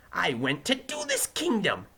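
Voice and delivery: high-pitched, insistent defendant; Weevil. A narrator's reading. Defendant giving evidence to the judge, high and insistent. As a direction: answer in a high, protesting voice.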